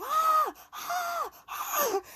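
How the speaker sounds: high piercing panicked screams; reliving the scream of terror in a spinning car; terrified screaming. Retold or imitated. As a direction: scream like your life is flashing by. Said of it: "start screaming at the top of my lungs".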